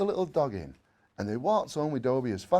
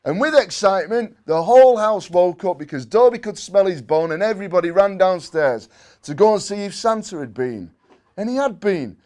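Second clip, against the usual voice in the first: excited narration; 7 semitones higher, 13 dB louder than usual. A narrator's reading. Excited Christmas morning narration.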